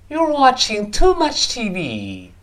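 "You're watching too much T V" drawn out, pitched high, falling at the end; slow, high model reading of "You're watching too much T V", ending in a fall; exaggerated intonation. Shown as a demonstration. Teacher models the complaint about TV, "You're watching too much T V" high and drawn out. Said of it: "音调的起伏".

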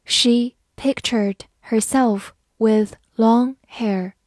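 slow, word-by-word model reading; teacher's slow read-along pace. Shown as a demonstration. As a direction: read it slowly with a gap after each word. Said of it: "慢慢来读".

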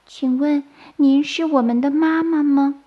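put-on high, childlike, tentative question; timid asking. A narrator's reading. Baby turtles timidly ask if she is their mother. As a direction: ask politely in a small hatchling voice. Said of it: "小声地问".

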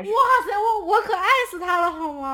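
squealing with delight, laughing; excited gushing. Real, not acted. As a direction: gush like a starstruck fan. Gushing over a favourite musician.

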